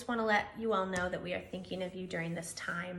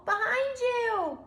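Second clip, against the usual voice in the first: teasing squirrel; 13 semitones higher, slower than usual. Acted. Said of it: "chuckled Squirrel".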